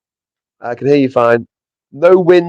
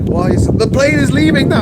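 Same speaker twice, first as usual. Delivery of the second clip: retold disbelief; 11 semitones higher than usual. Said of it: "I'm like".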